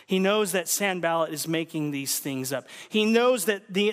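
ordinary delivery

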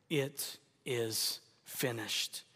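very slow, soft, low; last words. Acted. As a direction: let the words die away slowly. Jesus's last words quoted.

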